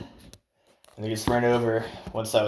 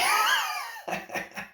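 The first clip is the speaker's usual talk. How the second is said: shrieking laugh of amazement; high amazed laugh. Real, not acted. Vlogger laughing at the old man's muscle-ups, high amazed laugh. Laughing after telling stunt.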